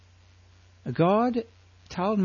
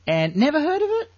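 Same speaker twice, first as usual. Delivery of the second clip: higher, teasing amazement; mock disbelief; 12 semitones higher, 4 dB louder than usual. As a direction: tease them, pretending shock.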